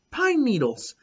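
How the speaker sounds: thrilled to find a treasure; excited discovery. A narrator's reading. Joyful discovery in pocket.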